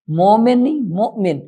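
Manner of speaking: ordinary delivery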